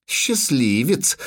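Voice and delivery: slow, drawn-out sweetness; sugary sweet. A narrator's reading. Girl teasing the dwarf beside her, sugary. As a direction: stretch it out, syrupy and needling. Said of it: "сладенько проговорила Цири".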